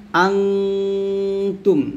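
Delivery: আনতুম drawn out slowly with a long nasal hum on the ন; nasal ghunna. Shown as a demonstration. A teacher modelling ghunna on আনতুম. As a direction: hum the ন in আনতুম before the তুম. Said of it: "আং গুণ হবে কিন্তু".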